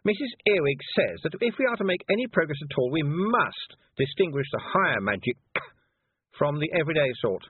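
high, superior lecturing voice; haughty young witch, lecturing. A narrator's reading. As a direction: explain loftily, as to inferiors.

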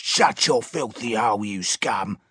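a sharp, harsh guard's screech, not shouted; mild mock screech at the scum. A narrator's reading. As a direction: spit it at the prisoner in contempt.

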